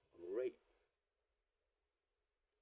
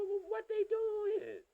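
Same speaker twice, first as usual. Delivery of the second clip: high, slow mock voice pitched way up; mocking question; 8 dB louder, much slower than usual. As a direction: sneer it out like a clueless parent. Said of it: "They go".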